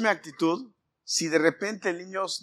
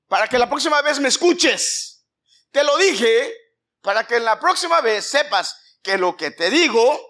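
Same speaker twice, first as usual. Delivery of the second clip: loud, higher scolding lecture; stern I told you; 6 semitones higher, 5 dB louder than usual. Acted. Father scolding child who ignored him, loud and stern. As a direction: drive the lesson home like a stern parent. Preacher voicing God scolding.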